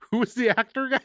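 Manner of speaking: laughing through the question; giggling as she asks. Real, not acted.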